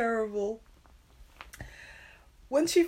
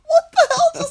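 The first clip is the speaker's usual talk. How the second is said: very high, loud laughing shout; shocked laugh; 15 dB louder than usual. Real, not acted. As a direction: shriek with laughing disbelief. Shock at absurd twist.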